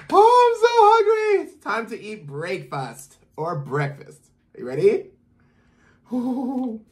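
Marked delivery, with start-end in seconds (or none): 0.1-1.4 very high, loud hungry cry; playful hungry squeal; eager. Real, not acted. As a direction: squeal with hunger before the bite. About to take a bite.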